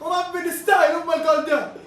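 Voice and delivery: high, excited shout; real shouted joy; joyful shout. Real, not acted. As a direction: shout the pride out. Celebrating goal.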